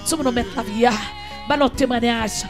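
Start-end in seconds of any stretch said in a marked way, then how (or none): none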